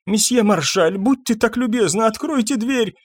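high-pitched, fussy doctor; meek, high-voiced doctor at the door; muttered polite request. A narrator's reading. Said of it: "бормотал доктор Фишер".

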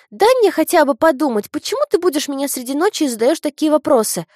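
высокий громкий детский голос; разбуженная девочка ворчит; недовольный ребёнок. A narrator's reading. Разбуженная дочь возражает. (недовольный детский голос)

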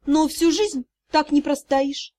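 usual speaking voice

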